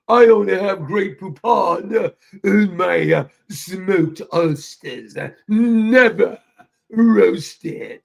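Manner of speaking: slow, loud mock British accent; hammed-up proper commercial voice, words drawn out; real proper talk. Parodying a Grey Poupon commercial, real proper. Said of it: "they used to be talk real proper".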